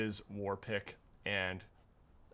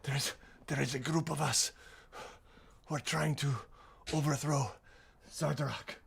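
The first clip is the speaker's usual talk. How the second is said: halting, higher voice of a hurt captive; pained pleading; 4 semitones higher, faster than usual. Acted. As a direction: plead in broken pieces between pained breaths. Pained captive bargains for his life.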